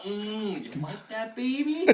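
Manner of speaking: high teasing phone-sex purr; hammed-up seductive line; mock sultry. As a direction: purr it like a cheesy lover.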